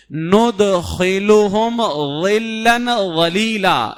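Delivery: slow ندخلہم ظلا ولیلا with a heavy ظ; correct recitation. Shown as a demonstration. Teacher giving the correct reading, slow. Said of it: "جو پڑھنا چاہیے".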